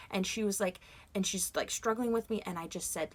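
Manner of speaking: usual speaking voice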